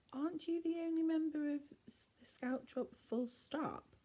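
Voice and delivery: high, teasing then slowed jab; mocking. A narrator's reading. Ella mocking Norman in front of the room. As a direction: tease him with a sneer.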